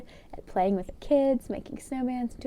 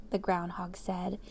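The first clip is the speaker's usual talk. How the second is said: plain reading voice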